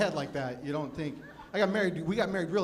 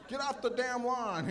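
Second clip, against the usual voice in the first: put-on slow, higher crotchety old-timer yelling at kids; grumpy old man voice; 8 semitones higher, slower than usual. Comic mimicking his inner grandpa, slow and crotchety. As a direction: play the cranky neighbor shaking his fist. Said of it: "your father's or your grandfather's voice".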